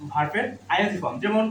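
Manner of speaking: ordinary talk, nothing marked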